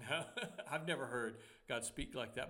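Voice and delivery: real laugh carried into speech; amused laughing. Real, not acted. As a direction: chuckle while dismissing your own joke. Laughing at own joke.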